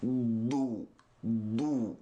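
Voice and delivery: ordinary talk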